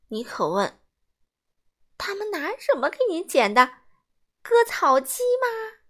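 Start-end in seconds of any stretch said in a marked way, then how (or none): 2.0-5.8 teasing, pitched high, slowing on 割草机吗; a kid jeering at a bad haircut; mocking kid voice. A narrator's reading. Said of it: "米格一边笑一边问". (嘲笑的孩子声音)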